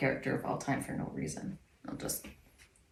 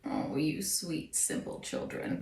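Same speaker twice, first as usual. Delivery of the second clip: slow, soft, cold villain voice; slow theatrical villain menace; 4 semitones lower, 9 dB quieter, slower than usual. Pretend. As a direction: purr it like a cold-hearted villain. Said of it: "best villain voice, [name]. Your cold ass bitch voice".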